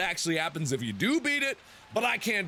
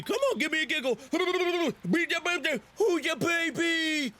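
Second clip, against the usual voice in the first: put-on baby talk; 6 semitones higher than usual. Cooing at the in-game baby.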